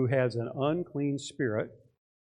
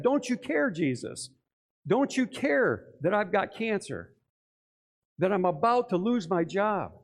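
desperate plea; 6 semitones higher than usual. Acted. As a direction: implore with mounting exasperation, stretching the key words. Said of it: "They started shouting at Jesus".

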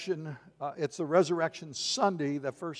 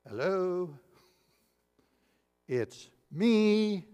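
mock attention-seeking; 2 semitones higher, 3 dB quieter, much slower than usual. Jesus trying to get noticed.

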